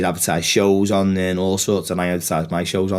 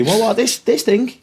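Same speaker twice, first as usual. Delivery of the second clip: much higher, coy voice; high mock innocence; 14 semitones higher, 3 dB louder than usual. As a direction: play dumb, high and coy. Mocking a show-off with a belt.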